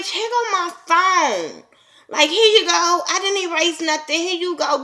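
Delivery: high, sassy mock handing over a phone; defiant. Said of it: "I would have been like".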